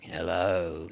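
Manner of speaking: low, drawn-out creepy greeting; sinister hello that makes you run; ominous stranger. Shown as a demonstration. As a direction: greet them with low menace. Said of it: "you might run the other way".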